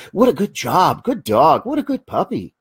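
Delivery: high, sing-song praise; teaching veterans the puppy voice, high happy praise. Shown as a demonstration. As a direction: praise the puppy like a delighted child. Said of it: "So you've gotta use the praising voice".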